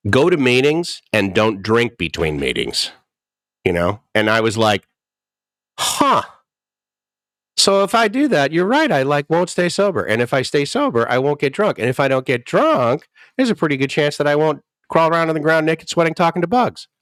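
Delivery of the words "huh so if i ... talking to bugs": mock naive realization. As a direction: play dumb as if the logic is brand new. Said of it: "Psh, logic".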